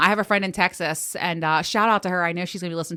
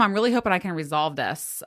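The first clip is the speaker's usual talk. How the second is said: ordinary talk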